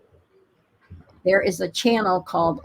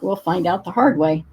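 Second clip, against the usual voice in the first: ordinary talk, nothing marked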